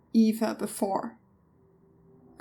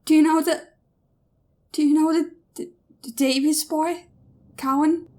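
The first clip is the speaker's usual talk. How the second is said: anxious; 5 semitones higher, 3 dB louder, faster than usual. Acted. Daughter asking nervously.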